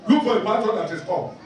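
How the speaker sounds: ordinary talk, nothing marked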